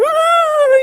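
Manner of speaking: high yelp like a dog; imitated dog yelp during nail trimming. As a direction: squeal like a dog getting its nails cut. Dog yelping at toenail trim.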